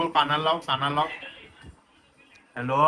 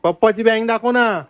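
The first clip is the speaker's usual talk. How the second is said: excited; 6 semitones higher, 7 dB louder than usual. Real, not acted. Loudly quizzing the child.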